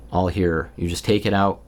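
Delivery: ordinary talk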